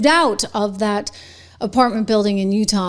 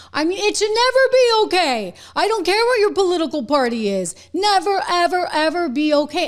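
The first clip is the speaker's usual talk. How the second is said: much higher, indignant pitch; outraged; 9 semitones higher than usual. Real, not acted. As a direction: sound genuinely appalled that anyone thinks so. Outraged commentary on poll numbers.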